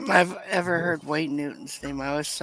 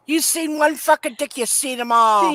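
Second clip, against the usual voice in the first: yelling; 8 semitones higher, 7 dB louder than usual. Retold or imitated. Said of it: "I said".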